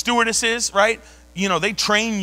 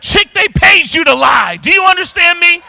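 retold shout; 8 semitones higher, 9 dB louder than usual. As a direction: yell at her to pull herself together. Scolding the panicking stewardess.

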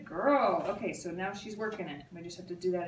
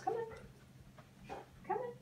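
airy high coaxing; 13 semitones higher, 10 dB quieter, much slower than usual. Real, not acted. Coaxing puppy, soft high voice.

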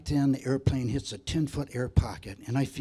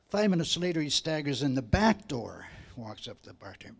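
ordinary talk

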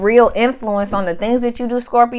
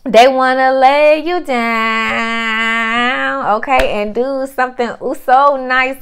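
seductive tease; 2 semitones higher, much slower than usual. Pretend. Teasing what the suitor wants.

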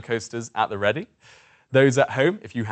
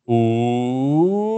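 ooh gliding up into an ee; rising ooh into ee; 5 dB louder than usual. Shown as a demonstration. Coach showing the roller coaster going up. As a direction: let the pitch climb as the vowel brightens. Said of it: "So it sounds like this".

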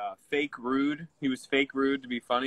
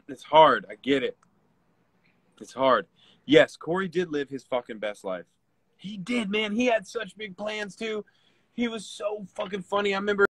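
grieving; 6 semitones higher than usual. Real, not acted. Grieving a friend who just died.

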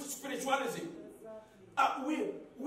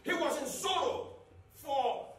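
ordinary delivery

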